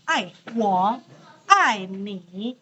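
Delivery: "Ai" falling, "wo" and "ni" dipping low; Mandarin tone demo. Shown as a demonstration. Teacher modelling love phrase, careful Mandarin tones. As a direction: drop "ai" sharply, sink "wo" and "ni" low. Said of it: "itu nada empat".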